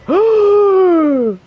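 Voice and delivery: long, high shocked gasp; loud gasp. Real, not acted. Streamer reacting to a find in the game, loud shocked gasp.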